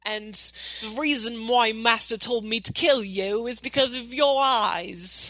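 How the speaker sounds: hammed-up villain, halfway Cockney and bad Australian; slow, drawn-out mock Cockney-Australian baddie; Tok, bad Cockney-Australian accent. Crocodile villain announcing herself to her target, goofy Cockney-Australian accent. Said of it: "why [name]'s doing that accent".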